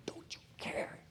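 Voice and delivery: a hushed, voiceless whisper of inner disgust; bitter whisper. Retold or imitated. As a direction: whisper it like a secret thought. Inner voice of despair.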